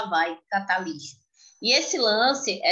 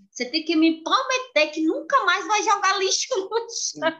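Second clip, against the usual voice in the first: put-on demanding child; 9 semitones higher than usual.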